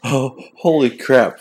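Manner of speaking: blurting in real alarm as she falls; real shocked outburst. Real, not acted. Host reacting to his wife falling on the stairs, shocked outburst.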